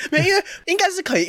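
fast and high through a laugh. Real, not acted. Laughing off the co-host's doubt, fast and high. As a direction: laugh the excuse out quickly.

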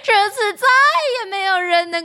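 high, triumphant wicked queen voice; gloating queen. Acted. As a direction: gloat over her victory. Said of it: "王后高兴地狂笑着".

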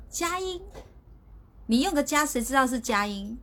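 quoting the teacher. Retold or imitated. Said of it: "然后阿德老师说".

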